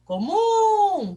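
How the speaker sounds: "Comum" called high and loud, "mum" drawn out; calling from afar. Shown as a demonstration. Teacher shows pupils the strong syllable, calling out "Comum". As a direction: call "Comum" as if hailing someone distant. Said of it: "chama longe a palavra".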